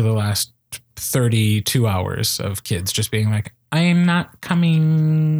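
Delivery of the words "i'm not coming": put-on slow, drawn-out whiny teen; teenager voice. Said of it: "Our teenager impression is getting so good".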